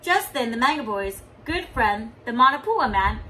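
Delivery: ordinary delivery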